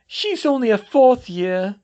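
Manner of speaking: high, loud, slow sob; a teacher breaking down in tears; sobbing. Acted. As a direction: plead through tears. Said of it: "Septima sobbed".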